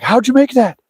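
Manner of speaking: a lightly voiced young player, stunned; amazed question. Young guys amazed at dad.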